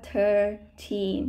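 put-on "thirteen" split slowly into thir and teen; correct THIRTEEN demo. Shown as a demonstration. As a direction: model "thirteen" with a long "thir". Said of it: "มันอ่านว่า thirteen". (อ่าน thirteen ช้าๆ ว่า เธอ-ทีน)

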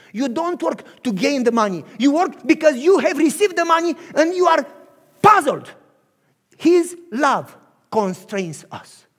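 loud fervent sermon climax; full-force preaching. Acted. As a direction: drive the point home.

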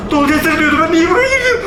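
high, fast, laughing alarm; panicky. Real, not acted. Gamer regretting entering the forbidden door, panicky laugh. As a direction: rush it out in nervous laughter.

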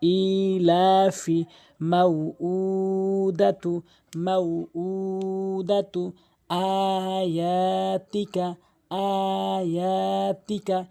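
slow, level recitation stretching "আয়াতিকা"; drawn-out long vowels. Shown as a demonstration. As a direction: hold the long "উ" inside "মাউউদাতু" on one note. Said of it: "এভাবে আপনি পড়বেন।". ("ইলাফি", "আয়াতিকা" ধীরে টেনে পড়া)

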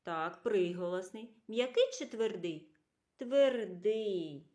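ordinary talk

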